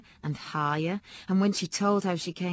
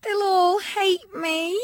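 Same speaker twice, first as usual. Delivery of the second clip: upset; 12 semitones higher, 7 dB louder, much slower than usual. A narrator's reading. Child upset and scared.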